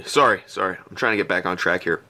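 ordinary talk

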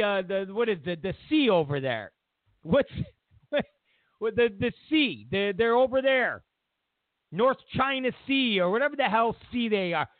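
ordinary talk, nothing marked

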